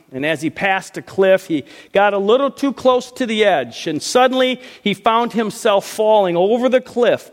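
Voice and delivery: animated preacher telling a funny story; lively joke telling. Acted. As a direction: spin the joke with rising energy. Tells a joke to the congregation.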